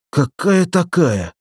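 high, drawn-out scornful question; dismissive, stretched-out questioning; wincing disdain. A narrator's reading. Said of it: "сморщился Валерик".

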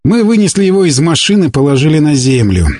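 usual speaking voice

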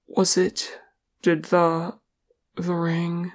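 halting, dread-filled question; hesitant, afraid to finish asking. A narrator's reading. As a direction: break it up with fearful pauses. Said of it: "asked Thrain with dread".

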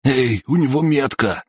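high-pitched goon outburst; blurted alarm. A narrator's reading. Third goon warning his mates, blurted high. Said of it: "выпалил третий из компании".

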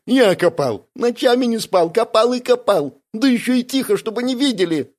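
high, plaintive whine; near tears. A narrator's reading. Бяка recalling nights of digging, near tears. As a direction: lament it high, almost crying. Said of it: "чуть не заплакал Бяка".

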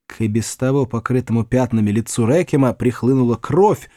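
ordinary delivery

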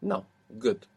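ordinary talk, nothing marked

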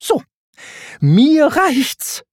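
high, exasperated cry; angry high-pitched outburst; Timon's fed-up yell. A narrator's reading. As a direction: snap that you've had enough. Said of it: "schrie Timon".

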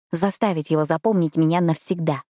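ordinary delivery

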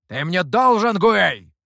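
loud, forceful insistence; loud, high accusing demand; pressing demand. A narrator's reading. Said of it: "напирал на него Михоу".

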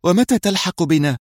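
high-pitched, lightly anxious question; asking woman. A narrator's reading. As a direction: lift the voice into a woman's plea. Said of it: "سألته منى".

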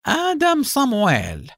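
name drawn out high and slow; high, slow villain's sneer; fierce sneer. A narrator's reading. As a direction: savour the name with a predatory grin. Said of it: "كشر حاييم عن أسنانه في ابتسامة شرسة".

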